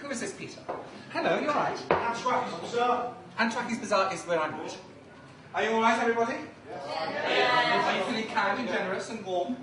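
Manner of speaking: ordinary talk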